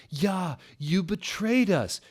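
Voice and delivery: high, slow angry shout; betrayed friend shouting, slow and high. Acted. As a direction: hurl the accusation. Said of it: "shooted Vampire angrily".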